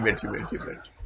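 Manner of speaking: soft whispered aside to the student; whispered sit down. Real, not acted. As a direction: breathe it out amused, almost silent. Laughing, waves student down.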